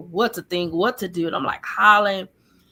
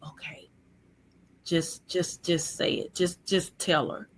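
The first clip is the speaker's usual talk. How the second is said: hushed, softer self-talk from memory; retold reluctant resolve; 5 dB quieter than usual. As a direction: drop the volume, quietly urging yourself. Recalls resigning herself to telling.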